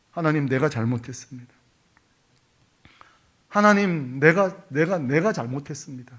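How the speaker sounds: a penitent whispering to God through tears; tearful confession to God. Acted. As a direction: confess like a sinner near tears. Acted weeping confession to God.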